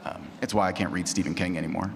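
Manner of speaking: ordinary delivery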